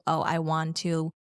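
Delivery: usual speaking voice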